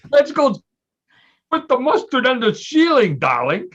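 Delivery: high, loud, hammed-up British accent; terrible British accent. Host imitating the prince at bedtime, terrible British accent. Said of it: "In my terrible British accent".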